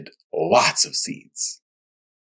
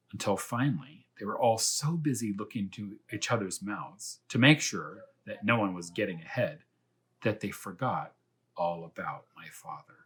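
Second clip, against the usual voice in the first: soft storybook reading voice; story narration; 8 dB quieter than usual. A narrator's reading. Reading a chapter book aloud to young viewers, soft storytelling. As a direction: read the chapter gently to the children.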